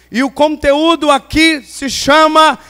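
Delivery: ordinary talk, nothing marked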